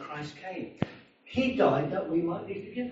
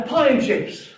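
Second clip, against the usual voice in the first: put-on loud, higher drunk demand; 8 semitones higher, 6 dB louder, slower than usual. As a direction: bark it like the drunk at the counter. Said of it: "And then he said".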